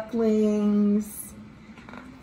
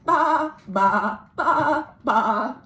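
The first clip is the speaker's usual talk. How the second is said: lamb bleats; 4 semitones higher, 4 dB louder, much slower than usual. Acted. Imitating lambs in story.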